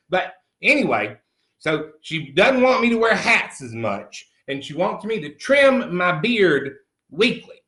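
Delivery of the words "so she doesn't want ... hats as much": ordinary talk, nothing marked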